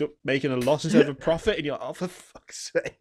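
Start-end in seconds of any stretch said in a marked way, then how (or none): 1.9-3.0 slow, unvoiced under-the-breath curse; a tired, whispered swear at the game; exasperated mutter. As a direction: mutter it in defeat, half whispered. Quoting own reaction to failing.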